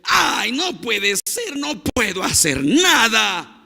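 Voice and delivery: high, slow, drawn-out frustrated yell; exploding child's shout. Retold or imitated. Scolded boy explodes at his father, imitated frustrated shout. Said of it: "el niño explota y grita".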